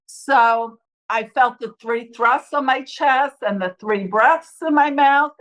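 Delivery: ordinary talk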